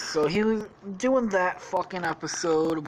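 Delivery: normal talking voice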